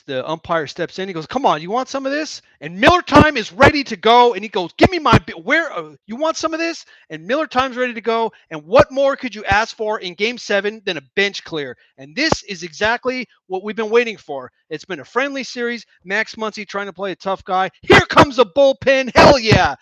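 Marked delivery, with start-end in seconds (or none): 1.3-2.4 high, heated "come at me" taunt; taunting yell. Acted. Voiced player taunting. 2.6-19.1 higher, louder, hyped-up commentary; excited play-by-play. Real, not acted. Commentator thrilled by bench clear. 19.1-19.8 loud, high shout of delight; whooping cheer. Real, not acted. Commentator cheers the brawl.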